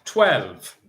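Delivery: slow sing-song number calling; bingo caller. Acted. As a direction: call each ball clearly, drawn out.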